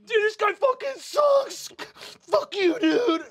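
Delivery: high, loud tearful cursing; mock crying. Humiliated bully cursing the one who threw him, crying loudly. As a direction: shout insults through put-on sobs, very high. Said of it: "Are you crying?".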